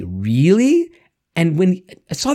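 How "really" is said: REALLY drawn out, rising into a question; stretched, climbing REALLY; questioning reply. Acted. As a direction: ask REALLY with a long upward swoop. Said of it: "But we'll often say".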